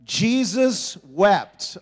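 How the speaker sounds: each word drawn out slowly, said together; slow group recitation. Shown as a demonstration. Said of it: "Let's say it together".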